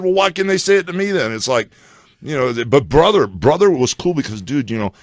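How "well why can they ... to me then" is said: retold protest. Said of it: "I said".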